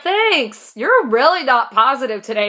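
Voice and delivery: high, loud retold sarcastic thanks; exaggerated, high-pitched comeback; mock-grateful comeback. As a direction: throw sarcastic thanks back at him. Retold comeback to gloomy advice.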